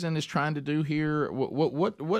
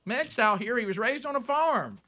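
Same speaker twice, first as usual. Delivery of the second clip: mock impressed voter; 7 semitones higher than usual. Host echoes co-host's mocking of voters, impressed high voice. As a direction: play a gullible Southern voter, pitched way up. Mocks voters won over by accent.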